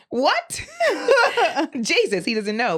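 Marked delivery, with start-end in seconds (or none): 0.0-1.8 high, bursting laugh of disbelief. Real, not acted. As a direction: crack up at the absurd compliment.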